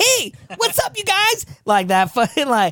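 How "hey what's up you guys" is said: Aziz Ansari voice. Retold or imitated. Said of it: "I would've been like".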